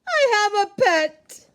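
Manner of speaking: high, loud and thrilled; excited. Acted. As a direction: announce it with pure joy.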